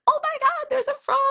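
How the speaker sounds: very high, squealing retold outburst; acted-out hysterics, pitch shooting way up; hysterical. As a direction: squeal it like you're hysterical over a surprise. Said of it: "like, hysterically".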